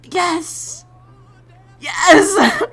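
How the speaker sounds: high-pitched, overjoyed squeal; thrilled. Retold or imitated. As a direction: squeal it in reenacted delight. Reenacting thrilled reaction.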